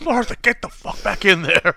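high, mock-angry order; harsh barking commands. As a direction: bark the order at his wife.

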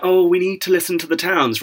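high-pitched mocking imitation; Lisa Nandy. Critic parodying a politician's vague pitch, mocking high voice. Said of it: "my issue kind of with Lisa Nandy".

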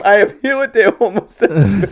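laughing through a mock interviewer line; imitating the interviewer. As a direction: quote him while cracking up. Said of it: "He doesn't even say".

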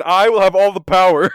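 loud, high hammed-up villain brag; mock power-mad boast. Boasting to cohost about D&D power, hammed-up villain gloat. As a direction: ham it up like a gloating tyrant.

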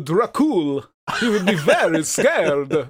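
Dracula in a really bad Italian accent; slow, hammed-up fake Italian. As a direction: botch a Dracula voice into cartoon Italian. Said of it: "like, a really bad Italian accent".

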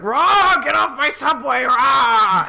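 loud, high roaring shout; a ghost roaring people off his train; angry subway ghost shout. Retold or imitated. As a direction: bellow at the passengers to get off. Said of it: "he's just like".

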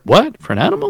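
shooting way up in pitch, baffled; a confused shopper squeaking out the question; mock surprise. Imitated shopper at the farm store, surprised.

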